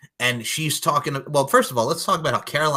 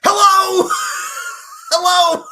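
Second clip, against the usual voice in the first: loud, high shouted hello; loud mock disbelief at the vampire's blood joke; incredulous shout; 11 dB louder, slower than usual. Real, not acted. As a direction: shout as if he's not even hiding it. Mocking the blood hint.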